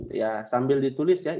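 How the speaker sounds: ordinary delivery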